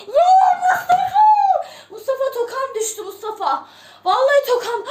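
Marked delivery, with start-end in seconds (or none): 0.1-1.2 high-pitched excited call; excited cry. Real, not acted. Streamer calling out to a gifting viewer, excited cry. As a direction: call the name out, thrilled.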